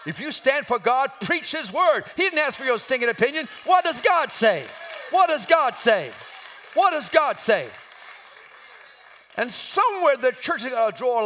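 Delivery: high, insistent pulpit shout; full-force preaching. Real, not acted. Preacher challenges timid preachers to stand for God, full-force preaching. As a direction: hammer it out as a sermon challenge.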